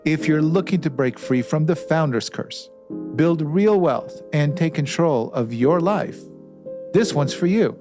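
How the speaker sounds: polished host intro over music, landing each phrase; measured promo read with deliberate pauses; podcast intro read. Acted. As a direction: introduce the guest like a show opener. Host's scripted show intro over music.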